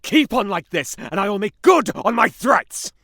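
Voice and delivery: very loud, high-pitched roar of rage; furious threat. Acted. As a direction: bellow the threat at full force. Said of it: "Don't get your knickers in a twist".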